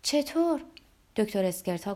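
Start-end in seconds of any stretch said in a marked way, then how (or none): 0.0-0.6 higher-pitched, surprised question. A narrator's reading. Olga questions the doctor's view of justice, surprised. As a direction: ask it with raised pitch, taken by surprise. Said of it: "اولگا تعجب کرد".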